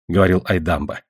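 plain reading voice

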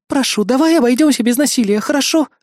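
high, anxious-to-please request; pleading. A narrator's reading. Kayli pleads for no violence.

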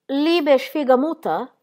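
high, slow, careful endearment; cautious smiling. A narrator's reading. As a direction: coax gingerly in memorized German. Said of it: "e sorridendo, seppur con piglio guardingo".

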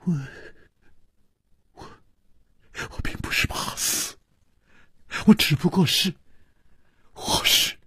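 breathy, faltering protest; quavering, halting, mostly voiceless; trembling voice. A narrator's reading. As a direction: stammer it out in broken whispers. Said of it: "他颤着说".

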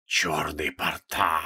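a hissing voice from inside the staff. Acted. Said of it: "раздался шипящий голос из посоха".

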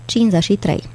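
ordinary delivery

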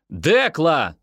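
acted cry, pitched high; calling out. A narrator's reading. Yoni stuck behind the barrier calls Dekla, crying out. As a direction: call after her, voice jumping up. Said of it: "закричал я".

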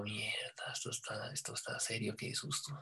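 hushed, frightened whisper to himself; scared whisper. Retold or imitated. Narrator whispering his own fear at the trance. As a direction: breathe it out in a scared hush.

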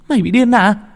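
high, loud, incredulous cry; shocked shriek. A narrator's reading. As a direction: scream it at her in disbelief. Hiền bật dậy quát chị. (hét lên kinh hãi)

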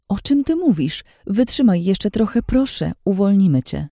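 high, distressed plea; tearful pleading. A narrator's reading. Pleading with dying friend to hold on, tearful pleading. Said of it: "nie mogłam powstrzymać łez".

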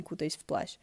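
plain reading voice

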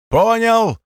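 high, loud droid chorus. Acted. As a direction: chirp it like battle droids. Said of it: "ответил хор высоких голосов дроидов".

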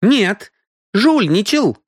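high, accusing woman's exclamation; exclaiming. A narrator's reading. Мисс Киан contradicts the cheat, exclaiming. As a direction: cry out the accusation, pitched high. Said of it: "воскликнула мисс Киан".

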